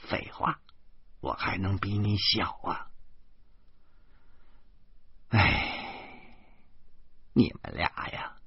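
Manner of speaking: a mother quietly telling them to rest; old mother. A narrator's reading. Mother's voice, softer. (老妈压低声音劝他们休息)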